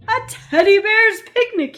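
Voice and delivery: loud, high gleeful bellow. Acted. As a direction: roar it with joy. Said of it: "he bellowed".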